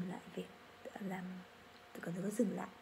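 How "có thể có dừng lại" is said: ordinary talk, nothing marked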